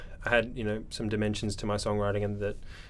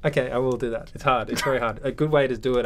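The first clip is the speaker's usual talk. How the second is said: usual speaking voice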